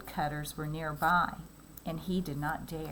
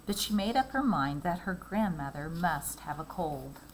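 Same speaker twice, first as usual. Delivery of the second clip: ordinary delivery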